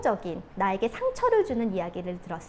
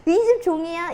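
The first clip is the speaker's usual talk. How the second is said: retold indignant outburst; 7 semitones higher, 7 dB louder than usual. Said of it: "말버릇이죠".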